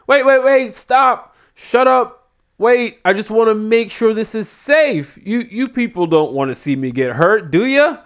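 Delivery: whiny wrestler. Retold or imitated. Wrestler pleading with booing crowd.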